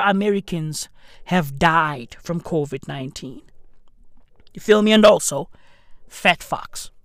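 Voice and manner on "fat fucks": usual speaking voice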